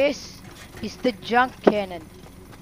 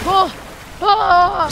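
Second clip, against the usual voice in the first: loud, high startled cry; higher, louder startled exclamation; startled yell; 9 semitones higher, 7 dB louder than usual. Real, not acted. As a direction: jump at the sudden hit. Sudden danger in the fight.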